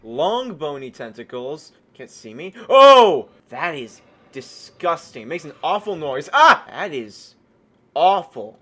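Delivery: usual speaking voice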